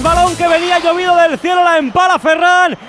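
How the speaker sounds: ordinary talk, nothing marked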